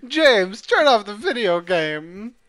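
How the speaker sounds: loud, slow mock scolding; mock yell. Friend yelling at James to stop the game, mock scold. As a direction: bark it at James like a fed-up parent.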